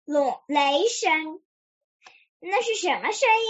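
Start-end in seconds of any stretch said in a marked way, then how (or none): 2.4-3.4 quick, higher-pitched mild surprise; surprised question. A narrator's reading. Piglet asks about a noise outside, mildly surprised. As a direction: ask fast with a touch of surprise. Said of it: "小猪惊讶地问".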